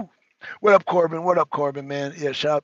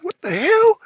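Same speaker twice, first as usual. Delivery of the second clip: shocked; 14 semitones higher, 8 dB louder than usual. Retold or imitated. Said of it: "you like, like".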